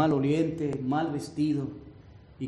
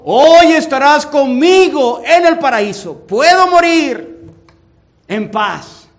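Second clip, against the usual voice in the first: defiant faith; 7 semitones higher, 14 dB louder than usual. Acted. Dying thief answers with faith.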